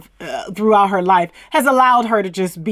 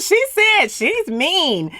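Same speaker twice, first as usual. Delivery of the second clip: mocking quote; 8 semitones higher, much slower than usual. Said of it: "She's talking about".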